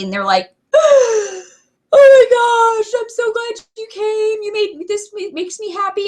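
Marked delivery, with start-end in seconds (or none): 0.7-1.4 sharp high gasp of relief. Retold or imitated. Customer at her door gasps in relief, high gasp. 1.9-6.1 tearful gush. Retold or imitated. Client in tears thanks the consultant at her door, high teary voice.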